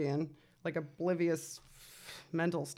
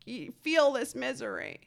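brain's voice; 6 semitones higher than usual. Acted. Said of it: "My brain wouldn't let me".

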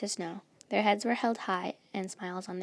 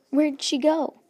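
high, loud yelled question; yelling; 6 semitones higher, 10 dB louder, slower than usual. A narrator's reading. Luna yells after Snow has vanished, loud and high. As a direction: yell it out, demanding to know. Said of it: "Luna yelled".

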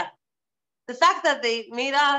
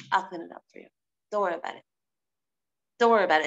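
soft reassuring; 7 semitones lower, much faster than usual. Retold or imitated. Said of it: "He says".

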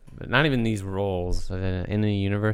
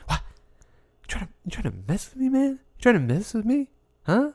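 high, over-the-top outraged yell; Tuco impression; 13 semitones higher than usual. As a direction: go way over the top, indignant and shrill.

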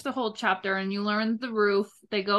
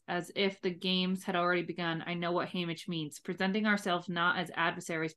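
reading aloud; 3 semitones lower, 5 dB quieter than usual. Acted. Reads a passage from the book.

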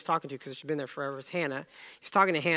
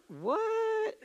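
baffled what. Retold or imitated. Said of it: "he was just looking at me like".

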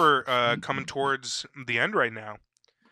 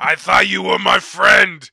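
loud, drawn-out, high cry of betrayal; betrayed movie shout; 6 semitones higher, 11 dB louder, slower than usual. Acted. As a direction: shout it slowly like a hammed-up mob scene. Acted gangster movie betrayal scene.

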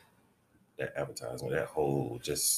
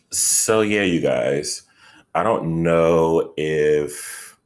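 emphatic address; 12 dB louder, slower than usual. Real, not acted.

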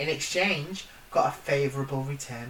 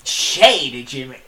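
put-on American accent; 3 semitones lower, 8 dB louder, slower than usual. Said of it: "that's the same".

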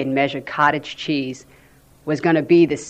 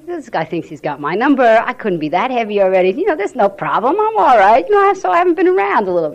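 defensive inner voice; 7 semitones higher, 6 dB louder than usual. Retold or imitated. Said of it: "You know, I'm thinking".

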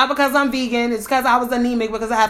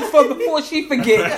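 normal talking voice

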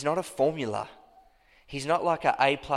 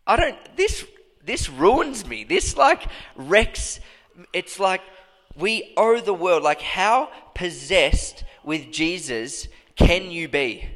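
high, cracking cry of awe; overwhelmed; 5 semitones higher, 3 dB louder, slower than usual. Real, not acted. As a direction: let it break out of you, undone. Overwhelmed preacher crying out.

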